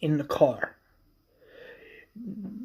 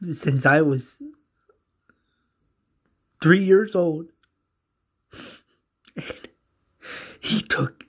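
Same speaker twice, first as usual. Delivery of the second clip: higher, louder, breaking with tears; grieving the man who raised her, in tears; tearful voice; 6 semitones higher, 5 dB louder than usual. Retold or imitated. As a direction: push the words through sobs. Character crying over death.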